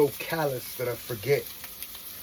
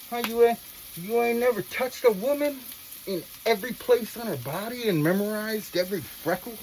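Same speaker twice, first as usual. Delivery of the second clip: excited rant; 6 semitones higher, 5 dB louder than usual. Real, not acted. Passionate rant about life's joys.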